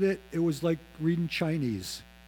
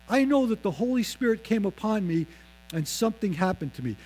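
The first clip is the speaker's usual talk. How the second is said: ordinary talk, nothing marked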